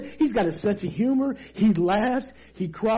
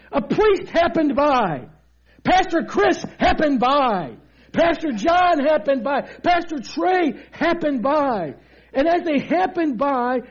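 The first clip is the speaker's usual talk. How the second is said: raised, emphatic sermon delivery; forceful preaching; 3 semitones higher than usual. Real, not acted. Preacher telling of the dangerous Jericho road, forceful. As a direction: drive each phrase home to the congregation.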